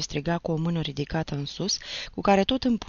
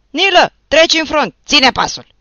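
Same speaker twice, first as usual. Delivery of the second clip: much higher, loud order; shouted from behind; 11 semitones higher, 15 dB louder than usual. Acted. Someone in the ranks shouts at Nilă, loud. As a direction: shout it from the back. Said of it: "strigă atunci cineva din urmă".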